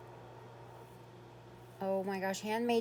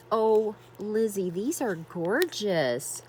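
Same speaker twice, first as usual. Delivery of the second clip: ordinary delivery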